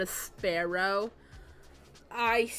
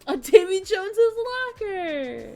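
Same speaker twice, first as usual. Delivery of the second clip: shouted with glee; excited; 10 semitones higher, 10 dB louder than usual. Real, not acted. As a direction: belt it out delighted. Excited echo of pirate phrase.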